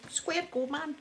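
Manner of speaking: Glasgow street accent. Shown as a demonstration. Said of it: "repeats the Glasgow challenge".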